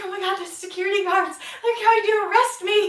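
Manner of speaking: loud laughing panic; panicked. Retold or imitated. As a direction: freak out that they're coming for you. Panic thinking arrest.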